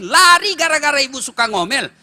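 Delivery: ordinary talk, nothing marked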